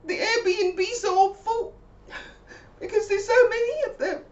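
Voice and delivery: high, loud, near tears; tearful high Mabel; teary friend. Retold or imitated. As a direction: rush on as if about to cry.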